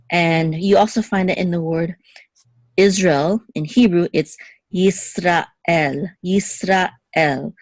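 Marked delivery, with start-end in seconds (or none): 4.7-7.5 Yisrael slowly split as Yisra, El; two separate words. Shown as a demonstration. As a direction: pause before the final el of Yisrael. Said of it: "as if it's two separate words".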